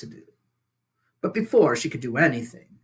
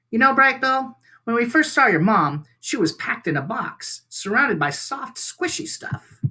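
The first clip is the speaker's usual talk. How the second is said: otter voice; 9 semitones higher than usual. Acted. Otter Shelley recalls meeting Roz.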